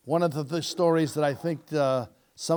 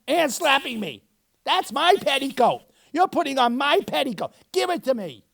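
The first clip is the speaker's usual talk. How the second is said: put-on high, bickering little-girl voices; two sisters snapping over a sock; squabbling children; 9 semitones higher, 3 dB louder than usual. Twin girls quarrel over clothes.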